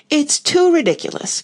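high, exasperated outburst; Alice losing her patience with the clumsy knight, crying out. A narrator's reading. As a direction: cry it out, patience gone. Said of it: "cried Alice".

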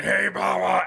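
put-on high, slurred drunk greeting; wasted guy. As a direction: mimic a drunk trying to talk. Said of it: "he's wasted".

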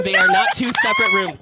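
loud, high protest; heated shout. Real, not acted. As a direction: argue it loudly. Heated ride argument.

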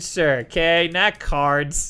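ordinary talk

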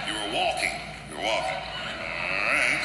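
low, slow cop voice taking a statement; a bored veteran cop, voice dropped low; weary police officer. Retold or imitated. Veteran cop questioning the kid, low and patient. Cop repeating the kid's answers.

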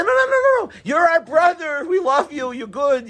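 high, gushing flattery, easing down; hammed-up soothing protest, starting very high; mock fawning reassurance. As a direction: rush in to placate, pitched high. Acts out flatterers reassuring a crying king.

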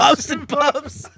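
slow laughing repeat. Real, not acted. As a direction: stretch the name with a laugh. Repeats joke name laughing.

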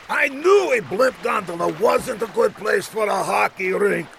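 high-pitched hammed-up griping; exasperated cartoon villain; vexed villain. Villain complains after falling through ice.

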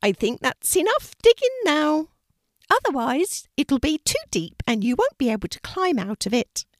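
high, loud little rabbit voice; gentle rabbit calling out to a scared puppy; bright high rabbit, loud. A narrator's reading. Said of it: "then said".

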